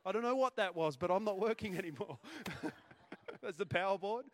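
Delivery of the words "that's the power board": laughing. Real, not acted. Laughs at mic failure.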